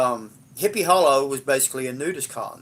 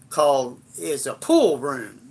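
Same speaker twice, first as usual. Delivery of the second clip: usual speaking voice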